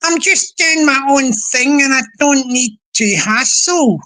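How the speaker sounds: ordinary talk, nothing marked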